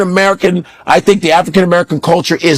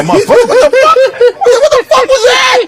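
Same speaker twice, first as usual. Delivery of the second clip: much higher, laughing mock disbelief; incredulous outburst. Viewer hearing an actor's real accent, incredulous high outburst. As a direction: shoot way up in comic outrage.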